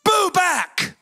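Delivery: high, punched comeback; defiant retort. Acted. The man fights the devil with God's word, defiant. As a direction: throw the scare right back at him.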